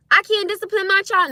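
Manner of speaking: high, loud, incredulous challenge; indignant disbelief. Real, not acted. Parent challenges critics about disciplining child, indignant. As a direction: throw it back as an outraged question.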